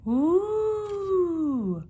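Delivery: high, loud ghostly howl; spooky howl. Acted. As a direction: howl like a Halloween monster. Spooky howling again.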